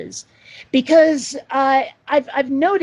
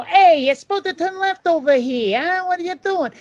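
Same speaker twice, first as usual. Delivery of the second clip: higher-pitched New York Italian tough talk; 6 semitones higher than usual. Acted. Guest mimics a GPS voice he can't get, New York Italian. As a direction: put on a brash New York Italian accent. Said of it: "a New York Italian".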